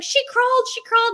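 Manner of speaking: high, loud imitation of an excited husband; loud, high-pitched retold excitement. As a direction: burst in with thrilling news, mimicking him. He came running in.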